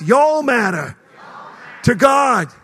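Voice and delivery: loud Southern y'all, lightly put on; preacher leading crowd in Southern drawl; Southern accent. Shown as a demonstration. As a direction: holler it like a Southerner. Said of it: "Say it like you're from the South".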